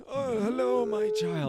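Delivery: high, sweet papal greeting; gentle pope. Retold or imitated. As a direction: coo it like a kindly pope. Voicing a present-day gentle pope.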